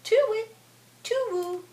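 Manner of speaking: high, drawn owl hoot. A narrator's reading. Mother reads the wild owl's call, high hooting. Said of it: "The wild owl hoots".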